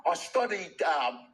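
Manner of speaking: imitating a British actor being interviewed; measured British interview voice; British accent. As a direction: put on a stately British accent. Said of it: "then I'm watching B B C".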